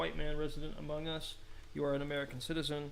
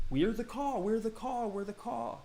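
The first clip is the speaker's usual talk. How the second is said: raised, insistent high voice; acted self-introduction; 8 semitones higher, 3 dB louder than usual. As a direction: announce the tribe's name in a high voice. Acting out tribe naming itself.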